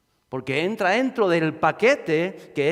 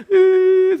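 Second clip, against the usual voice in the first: high, loud hammed-up wail; a policeman's hammed-up crying wail; 9 dB louder than usual. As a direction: burst into a mock blubbering cry. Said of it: "se echa a llorar".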